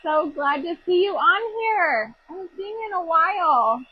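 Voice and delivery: high, loud, gushing hellos; excited greeting. Real, not acted. Host greeting a viewer arriving on her livestream, high and gushing.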